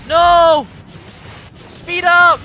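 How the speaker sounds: panicked high yell at the game; shouting. Real, not acted.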